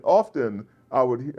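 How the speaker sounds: ordinary delivery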